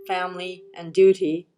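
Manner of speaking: ordinary delivery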